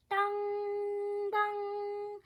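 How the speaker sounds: high sung dong of a bell; sung bell chimes striking midnight; clock chime. Acted. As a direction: ring out like the palace clock.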